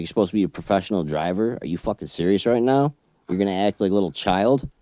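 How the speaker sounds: angry trucker scolding a fellow driver in the store; retold angry yell. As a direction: chew him out fast and loud.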